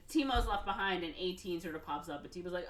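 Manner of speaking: normal talking voice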